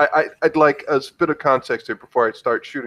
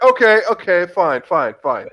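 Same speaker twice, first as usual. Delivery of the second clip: high, loud start, then dropping; exasperated surrender; 5 semitones higher, 3 dB louder than usual. Acted. Emily gives in to the reckless driver, exasperated. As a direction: give in hurriedly, pitch falling as you go.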